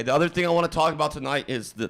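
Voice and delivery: ordinary talk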